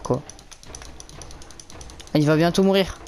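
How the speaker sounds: normal talking voice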